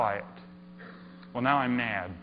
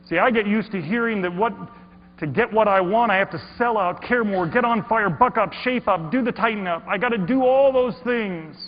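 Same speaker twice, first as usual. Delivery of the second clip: loud and high, hammering each demand; a fed-up loud tirade; bitter rant; 7 semitones higher, 6 dB louder than usual. Acted. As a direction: spit out the list like you're fed up. Ranting about selling out.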